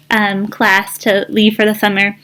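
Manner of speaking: plain reading voice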